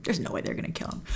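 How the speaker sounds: ordinary talk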